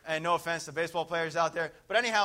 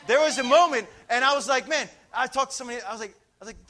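ordinary talk, nothing marked

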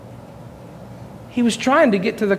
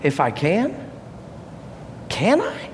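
hurt, incredulous question rising sharply at the end; soft, wounded disbelief, voiced for another; retold pained disbelief; 6 dB quieter than usual. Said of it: "in so much pain".

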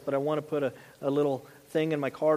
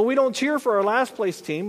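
usual speaking voice

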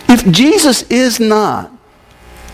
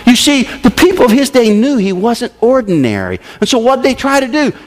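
ordinary talk

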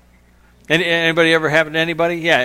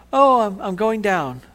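high, drawn-out mock alarm; slow, higher-pitched "sinking away" voice; slowly sinking Peter; 6 semitones higher, slower than usual. Peter slowly going under the waves, in mock alarm. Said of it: "didn't start to kinda, like, you know".

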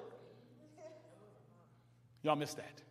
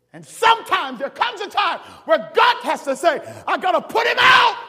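loud, rising preacher's call; hollering sermon delivery; preaching at full force; 7 semitones higher, 10 dB louder than usual. Acted. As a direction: build the run to a shout.

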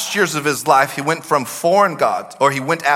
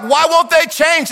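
exasperated church; 11 semitones higher, 3 dB louder than usual. Retold or imitated. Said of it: "And we're like".

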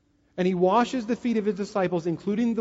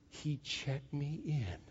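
deadpan realization; 4 semitones lower, 14 dB quieter, much slower than usual. Retold or imitated. Wife realises the prank.

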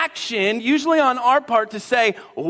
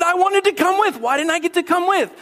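higher, whiny left-out complaint; mock whine; 8 semitones higher than usual. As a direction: sulk like a kid left behind. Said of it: "I said".

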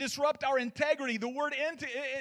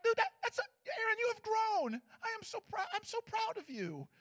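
high, soft, delighted praise; proud self-praise; 11 semitones higher, 7 dB quieter than usual. Retold or imitated. Aaron praising himself for walking away, high and soft. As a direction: gush over yourself like a proud parent. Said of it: "I was like".

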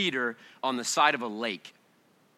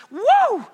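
ecstatic woo; 8 dB louder than usual. Real, not acted. Ecstatic cry in worship.

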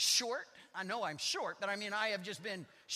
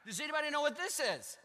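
ordinary talk

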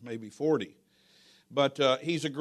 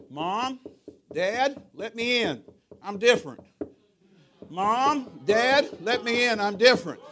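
higher, raised voice pleading through a door; son calling out; 7 semitones higher, 5 dB louder, slower than usual. Retold or imitated. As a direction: call urgently to parents to be let in. Acts son calling at locked door.

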